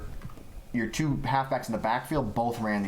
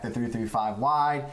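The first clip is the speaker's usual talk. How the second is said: usual speaking voice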